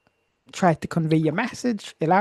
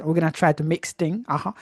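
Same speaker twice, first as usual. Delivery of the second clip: usual speaking voice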